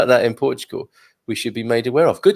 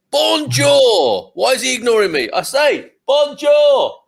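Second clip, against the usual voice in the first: much higher, shouted mock English tourist; English person abroad; 11 semitones higher, 4 dB louder, slower than usual. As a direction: hail them loudly, hammed-up and put out at being ignored. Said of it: "English people".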